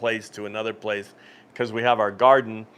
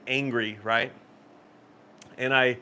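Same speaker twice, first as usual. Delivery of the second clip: normal talking voice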